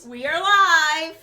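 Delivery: big slow announcer call, loud. Acted. Co-host announcing the show is live, loud and drawn out. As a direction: proclaim it like a show opener.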